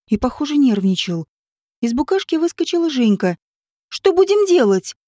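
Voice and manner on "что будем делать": calling out. A narrator's reading. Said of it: "прокричала она".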